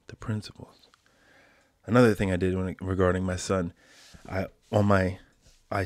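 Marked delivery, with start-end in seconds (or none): 0.1-0.9 whisper. Shown as a demonstration. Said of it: "It's the principles".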